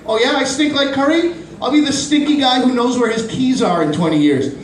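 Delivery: high, smug comeback; defiant kid comeback. Retold or imitated. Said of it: "I could have been like".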